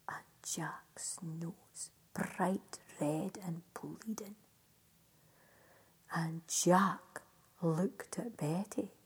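hushed storytelling. A narrator's reading. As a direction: let the ending fade to a whisper. Hushed close of the tale.

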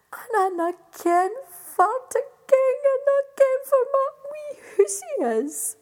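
high, loud, tearful wee fairy. Acted. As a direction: sob it out as a lost fairy.

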